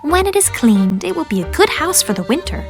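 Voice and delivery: high, lilting self-talk; Miss Susie, musing. A narrator's reading. Miss Susie to herself about winter, musing. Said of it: "said Miss Susie to herself".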